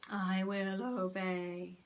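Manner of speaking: slow, monotone zombie voice; drawn-out robotic obedience; hypnotised trance. A narrator's reading. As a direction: submit blankly as if entranced. Margaret obeys in trance.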